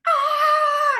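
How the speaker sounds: sudden loud scream; pained scream. Retold or imitated. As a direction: yelp as if cut open. Retelling sudden pain.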